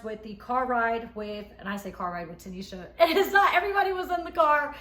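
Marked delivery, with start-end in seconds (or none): 3.0-4.7 words breaking into real laughter; laughing. Real, not acted. Recapping the wild car ride to viewers, laughing. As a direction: laugh through it, pitch up and louder.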